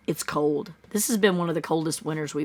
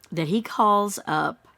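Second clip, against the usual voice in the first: usual speaking voice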